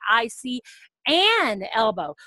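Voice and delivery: "an elbow" said slowly and clearly; correcting model. Shown as a demonstration. Teacher modeling the correction for the student, slow and clear. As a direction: model the right article slowly. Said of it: "we're ready to say".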